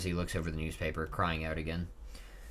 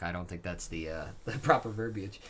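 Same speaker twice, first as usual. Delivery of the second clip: ordinary talk, nothing marked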